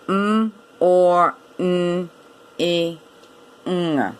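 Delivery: MORNING as separate sounds, M, OR, N, I, NG. Shown as a demonstration. Teacher counting sounds, "M or N E ng" sound by sound. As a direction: sound out "M or N E ng" slowly with gaps. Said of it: "At least five sounds there".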